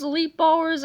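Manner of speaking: usual speaking voice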